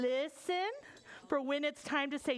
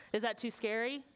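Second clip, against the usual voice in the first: ordinary delivery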